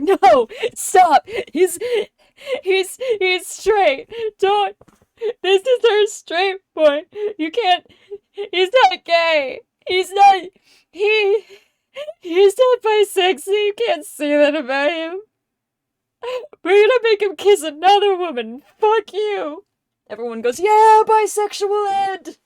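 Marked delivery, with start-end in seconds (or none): 0.0-19.6 high, loud, frantic protest; flustered Fox. Retold or imitated. Fox insisting to everyone their character is straight, high and frantic. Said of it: "Fox just starts crying". 20.6-22.4 high, loud, slow taunt; gleeful teasing. Retold or imitated. The group taunting Fox about Ed, high and gleeful.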